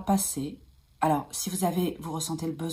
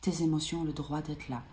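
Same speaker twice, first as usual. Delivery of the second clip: much softer, gentle self-reassurance; soothing mantra; 7 dB quieter than usual. Shown as a demonstration. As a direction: murmur it to yourself like a calming mantra. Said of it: "je me répète en mantra". (mantra apaisant, beaucoup plus doux)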